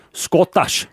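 broad Scottish pronunciation; a Scot saying Scottish; Scottish accent. Shown as a demonstration. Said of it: "Yeah, that's not bad".

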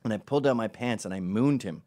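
ordinary delivery

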